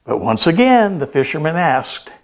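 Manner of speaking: ordinary talk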